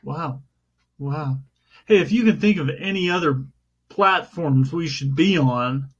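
ordinary talk

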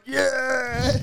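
high whooping mock yeah; a hammed-up cheer from the boys. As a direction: whoop like a pumped-up lad.

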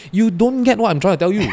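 high, loud mock-angry protest; retold exasperated outburst. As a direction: raise your voice at someone who won't listen, laughing underneath. Retold row over passing a message.

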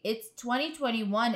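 ordinary talk

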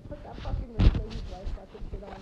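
telling the hooker friend loudly to dress up as the rich wife; loud instruction. Retold or imitated. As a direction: order her to get into costume. Telling Carrie to get in costume.

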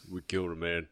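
low, distorted voice-changer growl; deep distorted monster voice. Pretend. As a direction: speak low through a voice changer. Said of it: "Like a monster voice".

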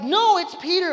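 high, loud protest; excited insisting. Retold or imitated. Rhoda insists to the disbelieving group, excited insisting. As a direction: act out desperately contradicting everyone.

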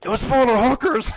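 slow, loud, high toast call; rowdy imitation of a pub toast; Irish cheer. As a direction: holler it drawn-out, rising to a yell. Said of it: "Yeah, and it was like".

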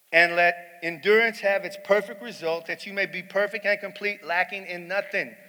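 lifted, ringing scripture read; preaching at full force. Acted. Preacher proclaims the verse to his congregation, at full force.

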